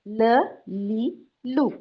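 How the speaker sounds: ल, ली, लू stretched very long, one by one; drawn-out vowels. Shown as a demonstration. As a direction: chant the three vowels slowly. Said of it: "इस तरीके से".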